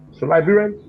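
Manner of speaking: loud, high call to the nation; rally the listeners by name. Real, not acted. Host calling out to listeners.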